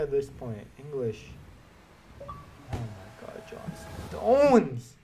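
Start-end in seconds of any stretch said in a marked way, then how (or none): 2.2-4.9 slow, stretched high outcry, louder; outraged wail. Real, not acted. Gamer pulls a player he hates, outraged wail. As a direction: wail the name in disbelief then cry why. Bad pull in pack opening.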